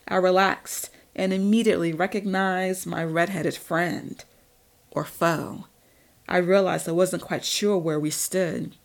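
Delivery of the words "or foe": ordinary delivery, nothing marked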